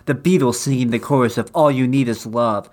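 usual speaking voice

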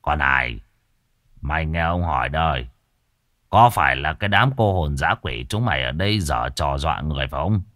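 low, menacing questioning of a ghost; stern interrogation. Acted. Exorcist grilling a captured ghost, low and stern. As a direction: press the spirit hard, threatening. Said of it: "lôi một con vong ra mà khảo".

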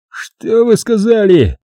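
can't believe his ears; stunned brother who has just been told to shut up; astonished. A narrator's reading. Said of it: "Он изумлённо воззрился на меня".

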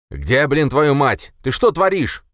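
loud, high, furious demand; outraged shouting; angry outburst. A narrator's reading. Boris bursts in, furious.

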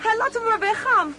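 high, loud, anxious plea; nervous urging. Real, not acted. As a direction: urge them to let the wasp go. Wasps swarm around them.

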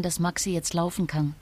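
ordinary talk

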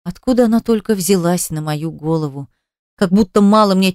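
plain reading voice

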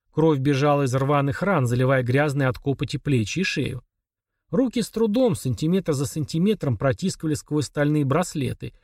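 plain reading voice

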